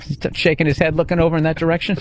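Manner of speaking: ordinary talk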